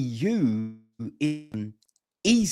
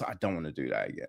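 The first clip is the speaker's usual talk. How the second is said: fast, quiet throwaway line, much lower and softer; fed-up mutter; 10 semitones lower, 11 dB quieter, much faster than usual. Retold or imitated. Filmmaker after a first film, muttering fed up. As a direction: mimic a worn-out filmmaker brushing it off under the breath. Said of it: "They're just".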